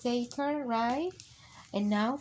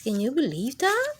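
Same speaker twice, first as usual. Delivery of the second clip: plain reading voice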